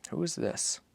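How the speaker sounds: put-on soft, high child's question; hushed boy's voice. Boy asks softly about the figure, hushed. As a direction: wonder aloud quietly.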